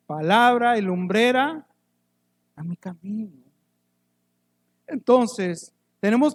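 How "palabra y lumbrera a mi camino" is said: reciting scripture. Acted. Recites a Bible verse.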